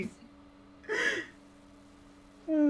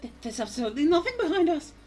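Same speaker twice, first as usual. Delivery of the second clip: high, stammering alarm; rising panic in a raised pitch; alarmed game character; 12 semitones higher, 5 dB louder than usual. Acted. Hero protesting nothing is behind them, alarmed.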